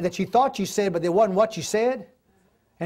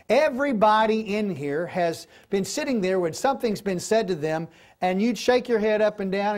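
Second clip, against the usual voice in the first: ordinary talk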